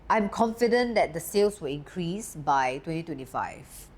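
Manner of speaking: twenty twenty-five said with the pitch stepping down at the end; confident downward statement tune; falling statement tune. Shown as a demonstration. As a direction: finish it grounded, tone dropping on "twenty twenty-five". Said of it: "the tone actually goes down".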